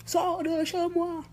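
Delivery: slow, high, drawn-out order to get out; mock order to leave, slow and high; retold order to leave. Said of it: "il dit, sors".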